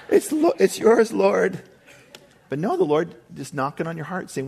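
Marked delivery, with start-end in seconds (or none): 0.1-1.6 sheepish laugh. Pretend. Caught, hands it to the Lord.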